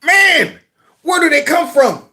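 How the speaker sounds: high-pitched, incredulous exclamation; exasperated. Real, not acted. Exasperated reaction to clip.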